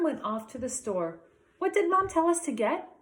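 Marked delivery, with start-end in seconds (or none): 1.6-2.9 put-on higher, louder young boy's voice; child's question. Josh asks his sister on the way to the store, high and eager. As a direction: ask it like an eager little boy. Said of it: "asked Josh".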